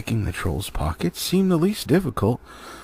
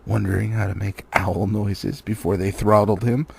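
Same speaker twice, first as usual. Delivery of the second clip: plain reading voice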